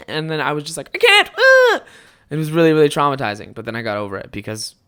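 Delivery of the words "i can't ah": much higher and louder, high shriek; hammed-up terrified cry; mock panicked wail. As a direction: play the kid being dragged in. Panicked child self quoted.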